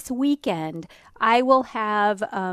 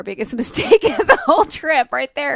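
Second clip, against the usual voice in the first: real laugh through the words, louder and quicker; 6 semitones higher, 7 dB louder than usual. Real, not acted. Co-host owning up to the trip's biggest mistake, laughing. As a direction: laugh while confessing the blunder.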